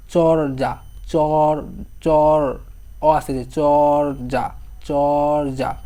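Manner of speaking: চর্যা slowly, a long "cho-o-r" before "ja"; stretched o sound. Shown as a demonstration. Teacher making students hear the vowel, first syllable drawn out. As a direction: hold the o in চর্যা. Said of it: "অ আসতেছে না?".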